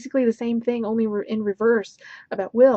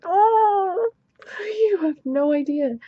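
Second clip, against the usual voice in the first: much higher, a crying wail of frustration; anguished wail; 9 semitones higher, slower than usual. Real, not acted. Reviewer wails to viewers about the book, half-crying. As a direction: wail it like it physically hurts.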